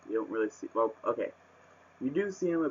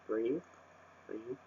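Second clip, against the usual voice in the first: calming oneself; 10 dB quieter than usual. Real, not acted. Telling self to calm down.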